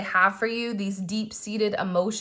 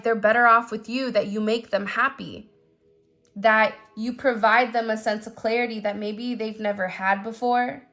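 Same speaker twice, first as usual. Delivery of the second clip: usual speaking voice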